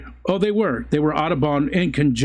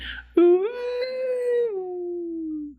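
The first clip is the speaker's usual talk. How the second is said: loon impression; 4 dB quieter than usual. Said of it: "your best impression of a loon".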